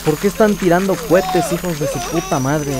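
yelling at the fireworks outside; loud, fed-up outburst; angry shout. Real, not acted.

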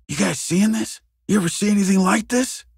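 high-pitched, rising questions; astonished. Acted. Investigator checks that colleagues see it too, astonished. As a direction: ask it high, incredulous. Second take of amazed questions.

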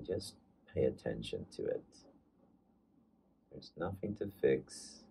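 calm meditation guide, leaving space between phrases; guided meditation. Acted. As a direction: ease listeners into following their breath, unhurried.

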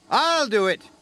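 high-pitched eager volunteering; exclaiming. A narrator's reading. James volunteers for the job, high and eager. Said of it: "exclaimed James".

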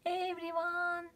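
high, ringing call for attention; shouted call. Acted. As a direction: call out to the whole crowd. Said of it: "shouted Super Tito".

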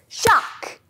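high, loud, sudden shark. Shown as a demonstration. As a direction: snap the word like jaws. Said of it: "big loud shark".

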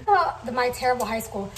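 ordinary talk

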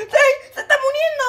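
high, crying panic; panicked cry. Pretend. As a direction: shriek that he is dying. Character dying panic. (grito de pánico agudo)

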